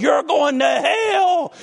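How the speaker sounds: slow, drawn-out hellfire shout, high and loud; imitating a shouting preacher. Mimicking the hellfire preacher to the church, slow shout. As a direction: bellow each word like a damning preacher. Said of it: "for twenty minutes we heard".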